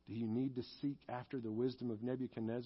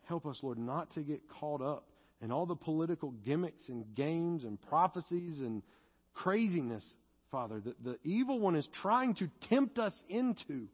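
prayer building higher and louder; impassioned prayer; 5 semitones higher, 5 dB louder than usual. Real, not acted. Preacher asks the Lord for help against temptation, impassioned prayer. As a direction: plead with God, rising to a loud, high cry.

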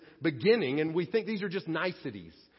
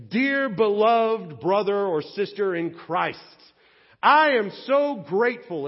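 gushing letter voice; 4 semitones higher, 9 dB louder, slower than usual. Pretend. Mock flowery thank-you letter.

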